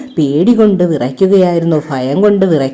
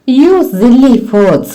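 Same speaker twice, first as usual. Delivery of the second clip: higher, slow teasing scorn; mocking mouse; 5 semitones higher, much slower than usual. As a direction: tease the fox for being foolish. Mouse mocks the fox. (എലി കുറുക്കനെ കളിയാക്കുന്നു)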